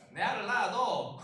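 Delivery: slow, loud Gyeongsang dialect drawl. Retold or imitated. Pastor imitating a Gyeongsang man proposing, slow dialect. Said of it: "경상도 프로포즈".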